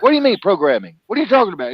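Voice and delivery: high, loud indignant challenge; mock angry retort. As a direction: snap back like an offended believer. Angry witness retorting in argument.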